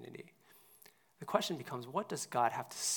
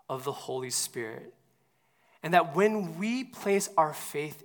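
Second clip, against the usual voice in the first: full-force preaching; 3 semitones higher, 8 dB louder than usual. Acted. Pastor preaching to congregation about the Spirit, full force. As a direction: preach it out with rising conviction.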